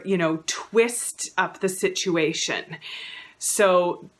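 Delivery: ordinary talk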